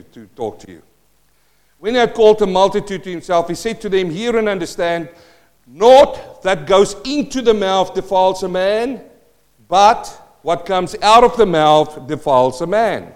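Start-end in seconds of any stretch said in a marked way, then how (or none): 1.8-13.1 loud, emphatic preacher reading; scripture proclamation. Acted. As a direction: proclaim the verse to the congregation, driving key words hard. Reads scripture aloud to congregation.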